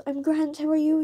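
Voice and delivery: high, chirpy retold reply; acted-out cheery chit-chat; friendly small talk.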